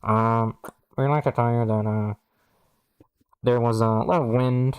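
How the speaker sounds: mock captain's announcement; pilot announcement. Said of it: "comes over the loudspeaker and goes".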